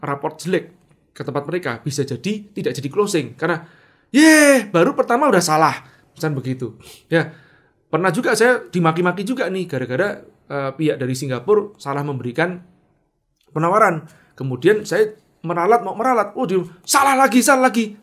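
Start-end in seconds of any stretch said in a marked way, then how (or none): 4.1-5.7 loud jeer, opening high; exasperated scolding. Retold or imitated. Customer scolding over a first mistake, exasperated. 16.4-18.0 high, loud mock complaint; exasperated outburst. Customer cursing over a repeated mistake, exasperated.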